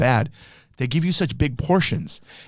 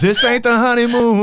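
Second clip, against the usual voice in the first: [name] impression; 8 semitones higher, 3 dB louder than usual. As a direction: sing it like you're about to throw up. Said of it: "sounds like he's about to throw up".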